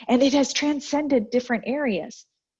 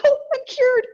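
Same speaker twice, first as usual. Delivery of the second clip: high, loud squeal of mock triumph; playful high-pitched cheer; 5 dB louder than usual. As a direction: crow it like a joke victory. Joking about her own breakthrough.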